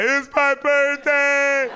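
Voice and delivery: very high, long drawn-out mock wail; comic silent-scream cry, voiced out; inner wail. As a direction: stretch every word into a hammed-up high whine. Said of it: "on the inside".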